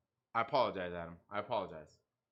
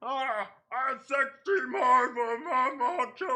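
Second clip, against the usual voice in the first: hammed-up mock shout; 6 semitones higher, 4 dB louder, slower than usual. Mocking troll, hammed-up voice.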